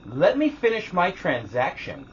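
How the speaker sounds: high, loud, slow demand; imitated rude customer. Rude lady turns on the waiting customer, imitated curt demand. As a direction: mimic her rudeness, loud and drawn out. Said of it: "Just like that".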